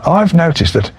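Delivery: a lightly voiced suspicious mother-in-law. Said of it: "My mother-in-law said".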